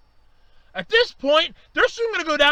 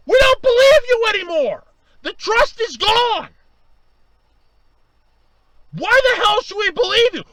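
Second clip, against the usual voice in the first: higher and louder, hurt accusation; betrayed anger; 4 semitones higher, 5 dB louder than usual. Real, not acted. Fan telling the network the trust is gone, loud and hurt. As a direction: hurl it at them, betrayed.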